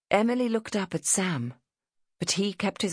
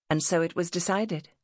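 ordinary delivery, nothing marked

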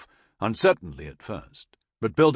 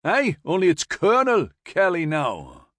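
high, raised Geordie; broad Newcastle accent; 7 semitones higher, 6 dB louder than usual. A narrator's reading. Corporal correcting the major on the rank, Newcastle accent. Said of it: "in a broad Newcastle accent".

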